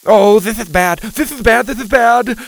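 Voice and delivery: high, loud frantic repetition; panicked cry. A narrator's reading. Friend being pulled into the hole, crying out in panic. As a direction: cry it out in rising alarm. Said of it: "Mac cried".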